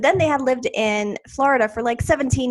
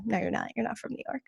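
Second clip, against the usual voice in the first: retold flat denial; 11 dB quieter, faster than usual. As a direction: quote yourself quietly shutting it down. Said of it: "I'm like".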